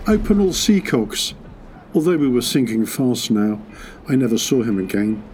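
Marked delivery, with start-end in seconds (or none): 0.1-1.3 officer's shout. Retold or imitated. Young officer ordering the crew on a sinking ship, retold shout. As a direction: call out an order, higher but restrained. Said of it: "I remember a young officer shouting".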